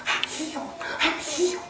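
high, hushed imitation of an amazed woman; freaking out. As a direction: squeak it softly, as if overwhelmed. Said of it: "She's freaking out".

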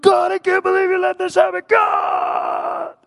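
high anguished scream at God; acted hysterical shouting, drawn out at the end; freaking out. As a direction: scream it, stretching the last words. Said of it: "He's just freaking out".

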